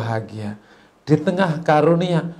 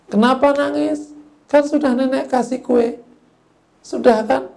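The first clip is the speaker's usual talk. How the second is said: much higher-pitched puzzled questioning; questioning grandmother; 9 semitones higher than usual. Acted. Shopkeeper granny asks crying child why, high puzzled questioning. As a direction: ask as a baffled old shopkeeper. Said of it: "Dipanggil sama nenek".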